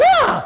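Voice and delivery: very high, loud shriek; shocked rabbit scream. Acted. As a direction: scream in cartoon panic. Rabbit sees turtle ahead.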